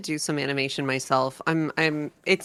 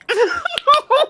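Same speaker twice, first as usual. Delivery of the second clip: loud laugh; 8 dB louder than usual. Real, not acted. Big laugh.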